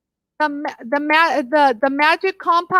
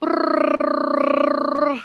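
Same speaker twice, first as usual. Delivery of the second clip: rolled-tongue drum roll for the prize draw. Pretend. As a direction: trill the tongue like a snare roll. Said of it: "Drum roll, please".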